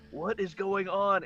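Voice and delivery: high, slow, loud disbelief; as if you can't believe what you're seeing; shocked bullies. Retold or imitated. Bullies watching Hori fawn, stunned and slow. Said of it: "They're like".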